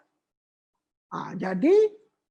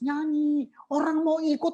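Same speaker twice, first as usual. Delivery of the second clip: ordinary delivery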